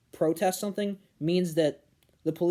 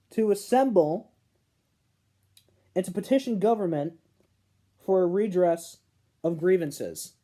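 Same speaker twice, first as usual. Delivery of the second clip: raised, loud, solemn recital of the text; formal reading; 4 semitones higher, 4 dB louder than usual. Acted. Reading the Bill of Rights aloud to viewers, in a solemn declaiming voice. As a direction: proclaim it like a founding document. Reads the First Amendment aloud.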